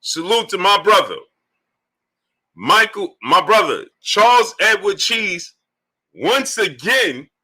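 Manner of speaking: drawn-out announcer's salute; hype donor shout-out. Acted. Host saluting a brother in the super chat, announcer-style hype. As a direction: stretch the name out like a ring announcer.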